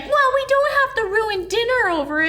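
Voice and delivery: very high, put-on pleading protest; mock protesting mother. Mother answers son saying "we're leaving", mock high protest.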